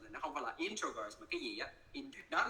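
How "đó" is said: ordinary talk, nothing marked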